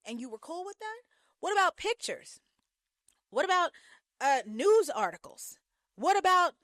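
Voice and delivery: exasperated questions building in pitch; incredulous. Real, not acted. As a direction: grill her like she should have known better. Disbelief at the woman's naivety.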